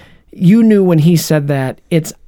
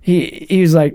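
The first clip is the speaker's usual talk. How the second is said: ordinary delivery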